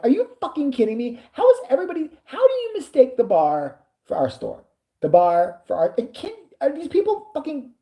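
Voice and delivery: high, rising outrage at the mix-up; furious retold outburst; exasperated disbelief. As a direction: voice climbs in exasperation. Angry retold reaction.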